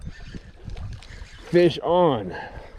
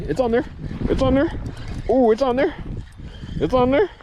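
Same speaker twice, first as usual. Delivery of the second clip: excited; 10 semitones higher than usual. Real, not acted. Hook spotted on the fish.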